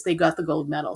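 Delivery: ordinary talk, nothing marked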